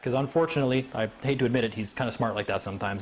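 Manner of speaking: ordinary delivery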